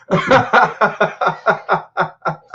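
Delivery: a long run of giggling laughter; laughing fit. Real, not acted. Streamer cracks up at a crude chat message. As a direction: laugh it out in bursts.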